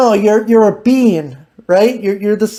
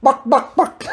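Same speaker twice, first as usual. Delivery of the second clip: chicken clucks; 4 semitones higher than usual. Acted. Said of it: "chicken again".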